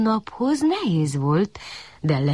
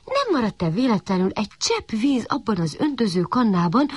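ordinary delivery

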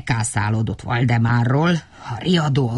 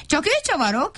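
high little indignant call; shouting; 13 semitones higher, faster than usual. A narrator's reading. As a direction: call it out, pointing at the other. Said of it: "kiabált".

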